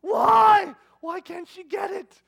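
loud high drawn-out wail of frustration; exasperated outburst. Retold or imitated. Dad venting in passageway after tantrum, exasperated yelling. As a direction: rant as if losing your temper. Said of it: "I don't generally lose my temper".

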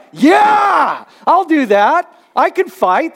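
loud, high rallying yell, easing off; fired up and ready to fight; fight mode. Acted. Listener answering a yelling preacher, fired-up shout. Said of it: "you go into fight mode".

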